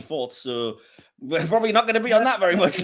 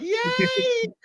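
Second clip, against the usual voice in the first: high, squealed mock yay; fanboy nerd cheer. Himself watching the show, acted fanboy nerd cheer. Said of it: "huge fanboy nerd being like".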